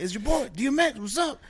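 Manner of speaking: high-pitched rapper soundalike introducing himself. Retold or imitated. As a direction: imitate a scrappy hustler's cocky greeting, pitched high. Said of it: "And perfect impression".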